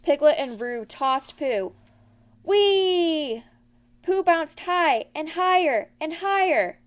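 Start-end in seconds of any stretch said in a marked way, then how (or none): 2.4-6.8 much higher, louder delight; gleeful whee. A narrator's reading. Pooh bouncing up toward the honey, gleeful. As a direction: soar with joy.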